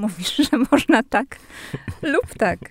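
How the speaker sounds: amused retelling of a friend, breaking into laughter; laughing quote. Retold or imitated.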